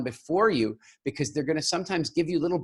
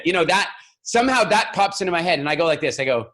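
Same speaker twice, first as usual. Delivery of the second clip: ordinary talk, nothing marked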